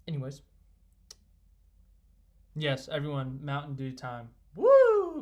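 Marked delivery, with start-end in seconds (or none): 4.5-5.2 a big, high, loud whoop; a high, loud "Woo!" cheering for a drink; excited whoop. Real, not acted. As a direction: let out a cheer of pure delight. Cheering for Mountain Dew time.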